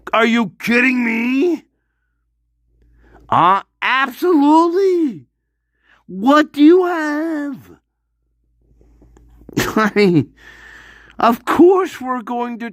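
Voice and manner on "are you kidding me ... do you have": high, disbelieving outburst; can't believe what he's hearing; incredulous. Real, not acted. Incredulous rant about plea deal.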